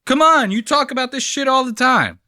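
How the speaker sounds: loud, high protest; high-pitched exasperated outburst; exasperated rant. Acted. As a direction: plead loudly, fed up. Rants back at the critics.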